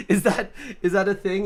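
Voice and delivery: giggling out the question; laughing. Real, not acted. Host laughing at a chat joke, asking through giggles.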